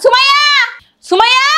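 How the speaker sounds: insistent rising summons; calling out loudly. Acted. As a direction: holler for your daughter, twice. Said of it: "এমন চিল্লাইতেছো কেন".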